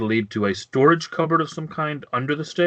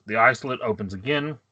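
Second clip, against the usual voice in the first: ordinary talk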